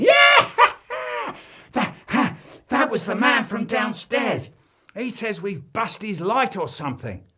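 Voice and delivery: high, loud, laughing through the words; giggling and panting. A narrator's reading. The boy telling his friends, still giggling and excited. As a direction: giggle it out breathless from jumping. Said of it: "panting and giggling".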